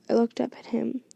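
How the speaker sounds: ordinary delivery, nothing marked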